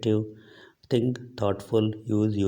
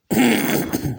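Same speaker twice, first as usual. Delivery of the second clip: a loud, harsh cough from a sore throat; real cough; 11 semitones higher, 6 dB louder than usual. Real, not acted. Teacher with a sore throat coughing loudly mid-lesson. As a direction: cough through a bad throat. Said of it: "sorry, आज गला खराब".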